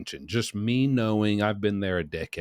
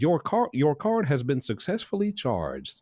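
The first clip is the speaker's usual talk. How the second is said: higher, even recorded voice; automated phone voice; 7 semitones higher than usual. Retold or imitated. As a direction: read like a payment confirmation. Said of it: "the automated voice says".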